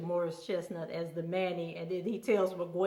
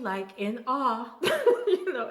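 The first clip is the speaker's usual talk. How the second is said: breaking into a real laugh, voice jumping higher; laughing in awe; 5 semitones higher, 5 dB louder, slower than usual. Real, not acted. Telling viewers how the show moved her, real laughter. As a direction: laugh through the words, overwhelmed.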